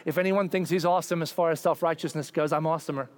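ordinary delivery

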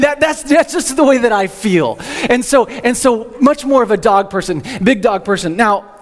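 ordinary talk

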